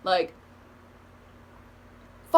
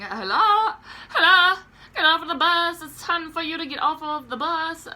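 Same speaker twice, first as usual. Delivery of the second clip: higher and louder, calling to wake someone; mock yell to rouse a sleeper on the bus; a hammed-up call of hello to a dozing man; 9 semitones higher, 5 dB louder than usual. Trying to wake sleeping passenger.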